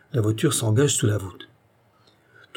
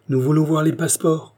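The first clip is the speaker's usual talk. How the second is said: higher-pitched demand from the crowd; insistent demand; 6 semitones higher, 3 dB louder than usual. A narrator's reading. Armed men demand papers from the king, insistent. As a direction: press them for papers. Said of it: "répondirent deux ou trois voix".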